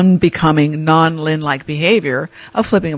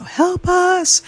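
desperate plea; 14 semitones higher than usual. Retold or imitated. Pleading with the front desk.

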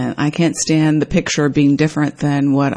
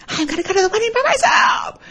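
mock huffy outburst. Venting to a friend about her late boyfriend, mock huffy. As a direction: blurt it like a sulking child. Imagined complaining text.

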